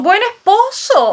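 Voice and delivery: high, incredulous retort; indignant echo. Acted. Mother answers daughter defending her husband, indignant and scornful.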